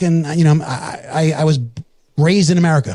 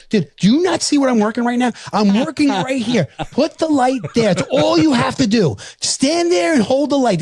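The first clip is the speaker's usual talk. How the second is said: mock exasperated yell; 8 semitones higher than usual. Father scolding son for moving the light, exasperated raised voice. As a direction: scold your son like a frustrated dad. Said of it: "I'm like".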